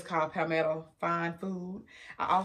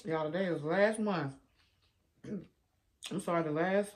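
speaking with food in mouth; pausing to chew between words; talking while chewing. Real, not acted. Seen 0: Closed-lip jaw motion and puffed cheeks between phrases; eating show throughout.

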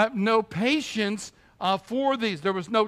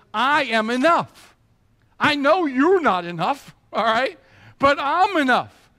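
higher and louder, ringing proclamation; God declaring sufficiency; 6 semitones higher, 6 dB louder than usual. Acted. God answering doubting Abraham, ringing and louder. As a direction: build it up and proclaim it.

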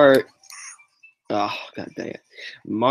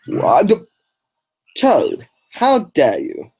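mock outrage; 6 semitones higher, 4 dB louder, slower than usual. Hit by Toad in race.

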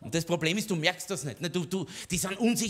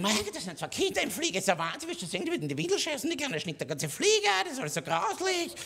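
high, fast, mock-shrieking complaint; griping granny; 9 semitones higher than usual. Comedian imitates a granny complaining about his kids on the plane, high and fast. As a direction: whine like a disgusted granny. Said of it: "die hat geschimpft".

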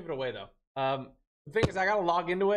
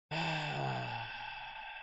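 frustrated sigh; 10 dB quieter than usual. Real, not acted.

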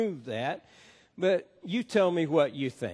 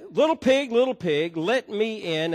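lightly voiced wolf, higher pitched and louder; big bad wolf; 6 semitones higher, 5 dB louder than usual. Pastor telling three pigs story, lightly voiced wolf at door.

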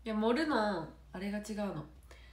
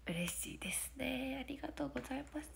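whisper; 4 semitones higher, 8 dB quieter than usual. Real, not acted. Quiet thanks to chat.

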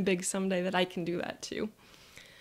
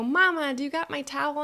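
put-on higher-pitched little kid asking mom; eager child to mom; 6 semitones higher, 6 dB louder than usual. Child asks mom for her towel, eager. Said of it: "I go".

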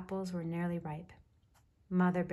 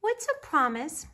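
put-on high, louder child's question; little bear cub; 9 semitones higher, 7 dB louder than usual. A narrator's reading. Baby Bear asks his mother as they dry off, little cub voice. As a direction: ask like a curious little cub.

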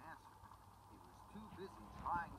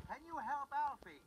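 high, loud pleading; urgent plea; 10 semitones higher, 6 dB louder than usual. Acted. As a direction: beg for help urgently. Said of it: "Miss Jenny cried".

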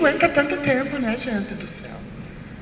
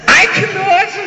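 loud, higher squeal of disgust; 6 semitones higher, 5 dB louder, slower than usual. Acted. Telling the audience about pentelho, a loud disgusted squeal. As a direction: recoil with a loud, shrieking "ai". Reacting to pubic hair, disgusted.

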